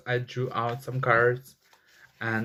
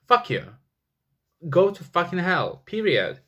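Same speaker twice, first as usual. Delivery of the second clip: higher, heated outburst; agitated outburst; 5 semitones higher than usual. Real, not acted. Ranting at killers, an agitated angry outburst. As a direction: spit it out in real anger. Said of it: "I'm getting agitated".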